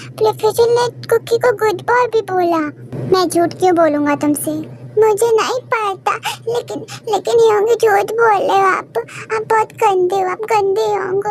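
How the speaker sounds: put-on high, sulky kid calling big brother a liar; whiny child accusing. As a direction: pout and protest like a small boy.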